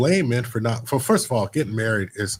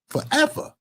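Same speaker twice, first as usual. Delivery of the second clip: forever shot way up high in disbelief; stunned realization; 14 semitones higher than usual. Real, not acted. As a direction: let it hit you that it never ends. Realizing alimony never ends.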